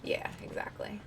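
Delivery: unvoiced whispered agreement; whispering. Real, not acted. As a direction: whisper it softly. Sudden hushed reply in game.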